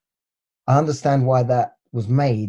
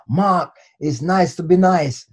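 put-on higher, slow Jamaican accent; Jamaican grandad; 4 semitones higher, slower than usual. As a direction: voice an old saying in hardcore Jamaican. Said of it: "hardcore Jamaican, and he always said".

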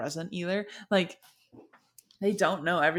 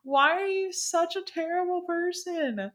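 much higher, appalled at such cruelty; mock outrage; 11 semitones higher than usual. Reader squealing at Odysseus in mock outrage. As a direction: squeal it out like you can't believe him. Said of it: "And I'm just like".

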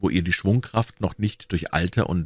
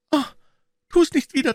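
put-on high, frightened girl's plea; terrified high plea; pleading; 3 dB louder than usual. A narrator's reading. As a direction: beg him to stop. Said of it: "flehte Becky".

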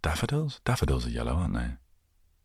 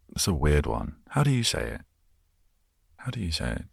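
ordinary talk

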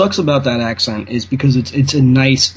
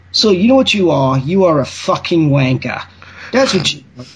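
mock-angry British insult, pitched up; angry British accent; 3 semitones higher than usual. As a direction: cuss them out in a put-on British accent. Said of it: "that does sound a little angry".

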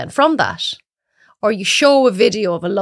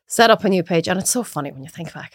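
ordinary talk

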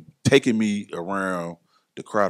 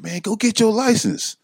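higher-pitched mock urging; Johnny's pushy urging; 13 semitones higher, 4 dB louder than usual. Johnny urging his friend to get licensed, higher mock voice. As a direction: push him like an eager friend.

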